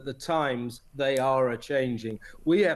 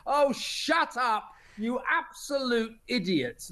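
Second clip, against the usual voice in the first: exasperated outburst; 7 semitones higher, slower than usual. Real, not acted. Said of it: "it's so infuriating".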